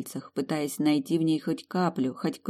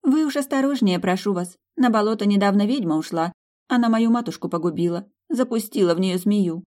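higher, urgent, worried warning; anxious waitress; 6 semitones higher, 4 dB louder than usual. Acted. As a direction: plead with him to be careful. Waitress warns of witch.

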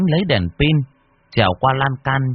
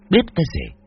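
ordinary delivery

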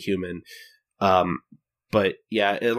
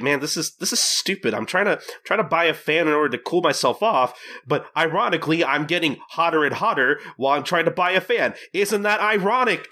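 higher-pitched comic griping; hammed-up complaint, pitched up; mock exasperated grumble; 6 semitones higher than usual. Gin grumbling about trying to buy a fan, mock exasperation. Acting out Gin's heat complaint.

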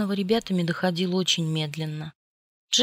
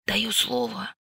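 hoarse croak; 3 semitones higher, slower than usual. A narrator's reading. Bella promising Jacob, hoarse. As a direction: rasp out the promise. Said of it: "прохрипела я".

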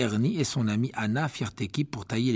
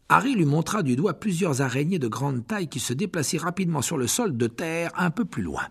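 usual speaking voice

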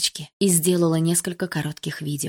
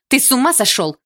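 higher, louder furious yell; angry shout; 6 semitones higher, 6 dB louder than usual. A narrator's reading. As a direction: yell at him in anger after fear. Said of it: "закричала я".

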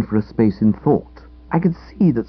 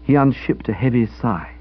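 ordinary delivery

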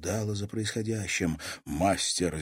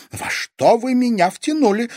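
high, loud, scared protest; panicked, raised-pitch question; frightened exclamation; 8 dB louder than usual. A narrator's reading. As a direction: cry out in alarm. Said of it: "испуганно воскликнула Дельфина".

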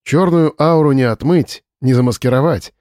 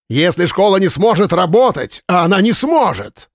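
angry shouting; 6 semitones higher than usual. A narrator's reading. Drunk boss shouting.

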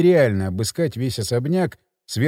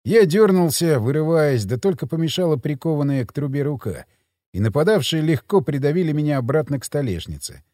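ordinary delivery

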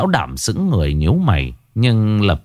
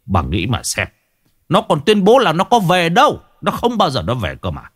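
high-pitched, indignant husband's rant; furious snapping; 6 semitones higher than usual. A narrator's reading. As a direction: rail bitterly against a disloyal son. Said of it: "điên tiết gắt lên". (gắt gỏng, giọng cao đầy phẫn nộ)

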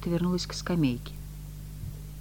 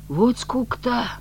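higher, mildly exasperated complaint; grumbling; 8 semitones higher, 3 dB louder, slower than usual. A narrator's reading. Messenger bored by her questions, grumbling. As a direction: grumble with weary impatience. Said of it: "проворчал Рыжий".

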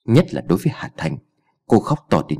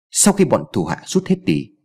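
ordinary delivery, nothing marked

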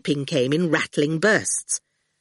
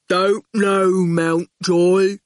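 very slow dim thug; flat, plodding enforcer; slow goon voice; 4 dB louder, much slower than usual. A narrator's reading. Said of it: "said the goon".

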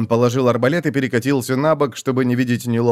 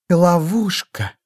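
hissing; 6 semitones higher, much slower than usual. A narrator's reading. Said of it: "прошипела Телораст".

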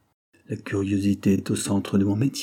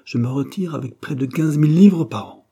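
raising his voice; 5 semitones higher, 5 dB louder than usual. A narrator's reading. Pollack boasts of retiring rich, raising his voice. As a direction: voice rising higher and louder toward the end. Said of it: "ajouta-t-il en élevant la voix".

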